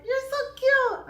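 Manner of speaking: very high, slow, loud coo; cooing at son. Retold or imitated. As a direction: gush over him like a baby. Mom cooing at son's muscles.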